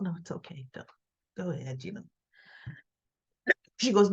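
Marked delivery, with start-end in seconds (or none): 0.0-2.0 quiet. Retold or imitated. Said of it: "Summer looks at him and she goes".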